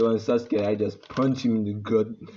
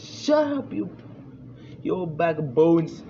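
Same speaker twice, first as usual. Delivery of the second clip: angry retort; 6 semitones higher, slower than usual. Acted. Hinata snaps back at father.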